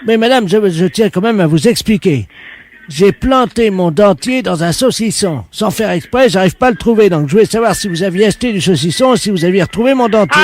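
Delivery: loud, put-on voice of an odd shop worker; a loud prank caller playing it dead serious. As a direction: fake tradesman on the phone, loud.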